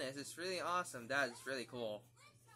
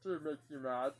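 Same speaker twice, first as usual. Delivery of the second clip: booming little Martian announcing itself; Marvin the Martian voice; 3 semitones higher than usual. Acted. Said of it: "Duck Dodgers as Marvin the Martian".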